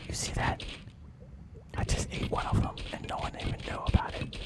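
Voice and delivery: hushed conspiratorial whisper; a shark whispering to the audience; sly whisper. Acted. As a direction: whisper the secret behind their backs. Shark confides secret to audience.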